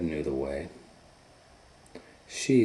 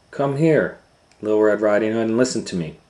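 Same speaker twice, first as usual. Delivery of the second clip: put-on loud, higher mother calling her girl; 4 semitones higher, 12 dB louder than usual. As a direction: call her over like a mom.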